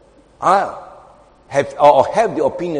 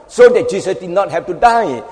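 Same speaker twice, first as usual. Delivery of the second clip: ordinary delivery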